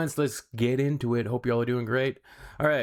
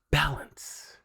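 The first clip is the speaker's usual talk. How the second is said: whispered mock-zen mantra; 4 semitones higher than usual. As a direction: breathe the word like a guru.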